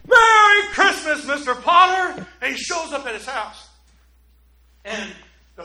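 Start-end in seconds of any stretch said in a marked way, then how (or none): 0.1-2.2 loud, high, taunting cheer; gleeful shout. Retold or imitated. George greeting villain Mister Potter with a gleeful shout. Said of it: "he says to Mister Potter".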